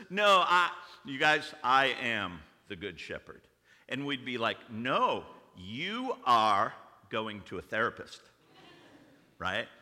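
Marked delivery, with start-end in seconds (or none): 0.1-3.4 loud, emphatic mock protest; acted insistence. Dinner guest pressing his claim on doubters, loud mock insistence. 4.7-8.2 mock firm rebuttal. Acted firm reply to Brad.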